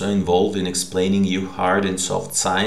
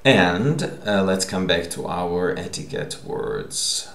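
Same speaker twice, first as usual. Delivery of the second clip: usual speaking voice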